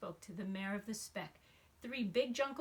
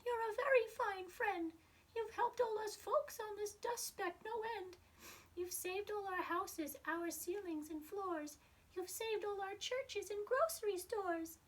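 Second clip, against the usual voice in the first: much higher, small far-off voice; tiny Who voice; 11 semitones higher than usual. Acted. The mayor of Whoville thanking Horton, tiny high voice. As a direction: squeak it up like someone no bigger than a speck. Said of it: "came the voice".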